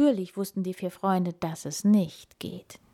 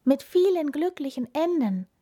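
high, happy little hedgehog; storybook glee; delighted; 9 semitones higher, 4 dB louder than usual. A narrator's reading. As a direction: rejoice with voice lifted. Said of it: "freute sich der Igel".

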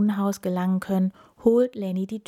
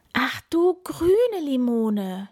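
slow, higher groan; 6 semitones higher, 4 dB louder, slower than usual. A narrator's reading. Lenny caught in the rain, slow dismayed groan. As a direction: drag it out in dismay. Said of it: "stöhnt Lenny".

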